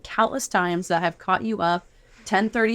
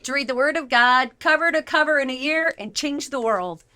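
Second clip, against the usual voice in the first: enthusiastic host intro; 6 semitones higher than usual. Acted. Host opens podcast episode.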